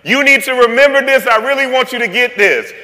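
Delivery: loud, high call across the room; booming shout to get attention; God calling out. Retold or imitated. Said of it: "that's God saying".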